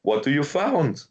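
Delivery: mock the actress who makes every line a question; mock questioning lilt. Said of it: "raises her voice up like it's a question".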